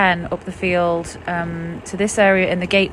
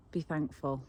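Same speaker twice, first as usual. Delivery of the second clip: usual speaking voice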